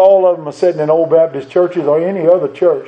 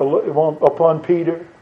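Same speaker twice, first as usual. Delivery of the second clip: normal talking voice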